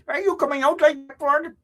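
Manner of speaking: high put-on Somali accent. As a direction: ask it in a mock Somali accent. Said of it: "In my Somali voice".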